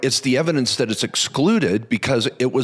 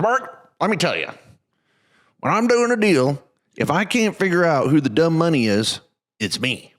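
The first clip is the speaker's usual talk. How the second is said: put-on terrible Texan accent; 6 semitones higher than usual. Host retelling his Texan investor's advice, bad Texan accent. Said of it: "that was a terrible Texan accent".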